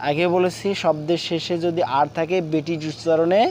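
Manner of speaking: usual speaking voice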